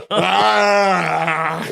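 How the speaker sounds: long, loud, drawn-out wail; mock drunken wail. Telling mates about being stranded drunk, mock wail.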